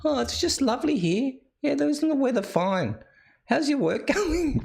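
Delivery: high, chirpy mock-polite chit-chat; mock small talk. Host mimics listeners making small talk, mock-polite. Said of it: "just watch you be just all like".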